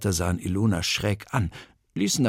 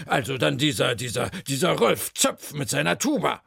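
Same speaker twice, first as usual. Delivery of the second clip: flustered, stumbling, higher voice; worked-up character voice, higher; agitated guess; 4 semitones higher than usual. A narrator's reading. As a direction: grope for the name in agitation. Agitated Schnöpf guesses next suspect.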